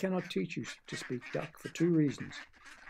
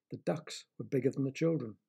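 ordinary delivery, nothing marked

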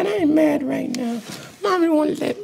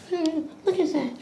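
usual speaking voice

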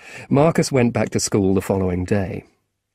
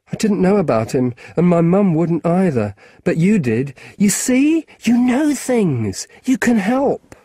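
put-on high, earnest young boy; boy begging a grown-up for help; pleading boy; 7 semitones higher, 3 dB louder than usual. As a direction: beg him to help. Marcus voiced, pleading.

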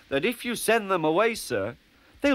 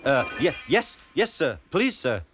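nervous stammer; 3 semitones higher, 4 dB louder than usual. Acted. Nervous Percy stammers to controller.

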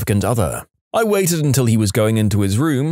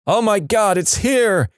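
high-pitched acted alarm; mock scream; 9 semitones higher than usual. A narrator's reading. Kids pranking the little brother, high mock scream. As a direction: cry out in fake panic, pitched way up. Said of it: "Immediately we both scream".

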